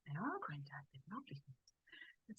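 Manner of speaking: very soft, half-whispered retold answer; hushed reply. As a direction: drop to a small, near-whispered voice. Child's answer to granddad, retold.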